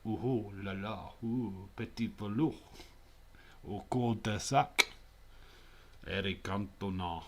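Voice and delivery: slow, hammed-up French; mock-French ooh-la-la voice; French accent. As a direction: put on a cartoon French accent. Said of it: "There you go, that's my French accent".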